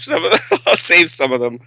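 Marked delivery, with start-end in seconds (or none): 0.0-0.4 laughing through the words. Real, not acted. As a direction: let a chuckle break into them. Laughs mid-sentence, then repeats.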